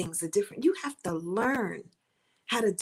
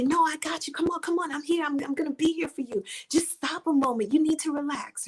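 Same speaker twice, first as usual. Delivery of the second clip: comforting friend; 6 semitones higher than usual. Retold or imitated.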